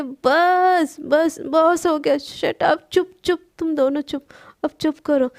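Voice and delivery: loud shout, slow on the first words; yelling stop. Acted. Sana shouts to stop the two fighting roommates. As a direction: bellow at them to shut up. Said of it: "तभी सना जोर से चिल्लाती है".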